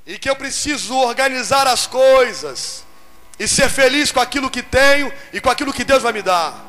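ordinary talk

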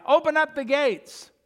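loud high cry at the gates; calling out. Acted. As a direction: shout the challenge from the tower. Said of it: "when they hear the cry".

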